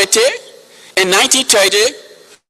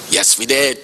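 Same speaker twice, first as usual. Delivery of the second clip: normal talking voice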